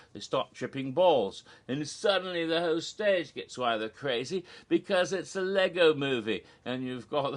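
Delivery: normal talking voice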